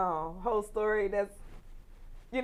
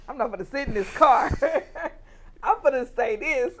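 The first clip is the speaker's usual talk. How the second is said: loud, fast, laughing through it; bold declaration; 4 semitones higher, 6 dB louder than usual. Retold or imitated. Laughing bold vow to the listener. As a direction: declare it boldly, half laughing.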